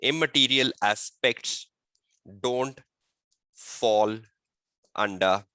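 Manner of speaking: slow, measured dictation with long pauses. Real, not acted. Teacher dictating a model exam answer to students, slow and deliberate. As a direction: dictate word group by word group for note-takers.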